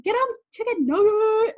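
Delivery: high, loud, drawn-out cheer to a pet; playful high holler, words stretched; calling to dog. Real, not acted. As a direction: egg the dog on loudly. Calls out to her barking dog.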